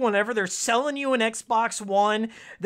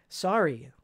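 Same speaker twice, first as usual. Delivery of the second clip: ordinary delivery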